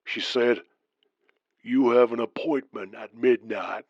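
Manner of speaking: put-on Southern trucker's accent. Caller Randy continues his story.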